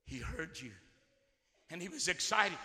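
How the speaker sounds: quiet intimate aside after the roar; hushed. Acted. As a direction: drop to a near hush. Drop after climax.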